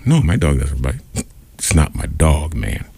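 low, unbothered comic reply; movie-line deadpan. Acted. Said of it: "just like that movie".

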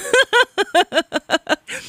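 high, rapid-fire giggle; long run of quick high giggles; giggling laugh. Real, not acted. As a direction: let a high giggle bubble out. Host laughs on air.

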